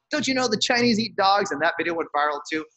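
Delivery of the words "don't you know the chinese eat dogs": retold yell. Activist retelling a heckler's yell, mock yelling. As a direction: toss it out like the heckler did. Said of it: "yelling racial epithets at me".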